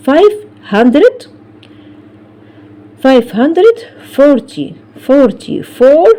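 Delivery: slow, careful counting out; slow number reading. Shown as a demonstration. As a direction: say each part of the number clearly. Teacher reads number slowly.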